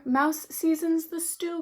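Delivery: ordinary delivery, nothing marked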